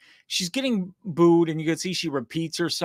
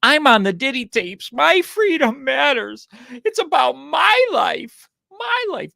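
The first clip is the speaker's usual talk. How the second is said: higher and louder, slow mocking parody of her speech; a louder, rising, slow parody of the endorsement speech; mock J-Lo plea; 7 semitones higher, 6 dB louder than usual. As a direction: ham up her plea, climbing higher each line. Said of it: "she should have said".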